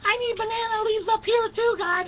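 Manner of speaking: high, loud squealing woman's voice; high falsetto plea for leaves; whiny Eve. Acted. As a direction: shriek like a fussy girl begging. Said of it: "Eve is going".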